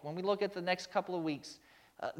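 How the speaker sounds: ordinary talk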